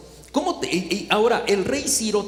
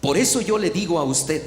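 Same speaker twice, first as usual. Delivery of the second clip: usual speaking voice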